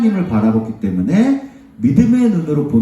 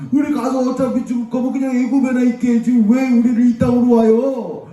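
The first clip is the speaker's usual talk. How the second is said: high, sustained mock whining; wailing complaint; 10 semitones higher than usual. Israelites grumbling that they should return to Egypt, high-pitched whining. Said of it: "불평을 하기 시작하는 거예요".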